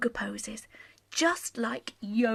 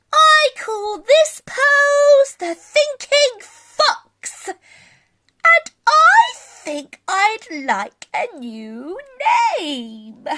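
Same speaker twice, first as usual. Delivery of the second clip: high, slow, musing fox voice; a little fox wondering aloud about a new name; high, slow fox voice, thinking it over; thoughtful Scaredy Fox; 12 semitones higher, 11 dB louder, slower than usual. Acted.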